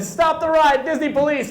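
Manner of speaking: high, loud frantic yell; panicked shriek. Retold or imitated. Lady on the ride calling for help, high loud shriek. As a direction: scream it at the attendants. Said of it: "She said".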